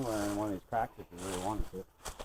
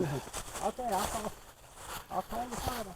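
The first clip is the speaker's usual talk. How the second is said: very high motherly voice; fussing mom voice; 11 semitones higher, 5 dB quieter than usual. Pretend. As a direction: chirp it like a flustered mother. Pretend phone call, mom answers.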